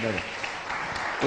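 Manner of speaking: इधर बैठो whispered slowly and softly. Real, not acted. Host hushing the child to sit, soft whisper. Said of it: "यहाँ बैठो, इधर बैठो".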